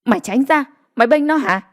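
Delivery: an irate mother ordering her son aside; screeching in anger. A narrator's reading. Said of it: "Hai người phụ nữ cũng rít lên". (rít lên tức giận)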